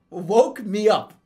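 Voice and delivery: ordinary delivery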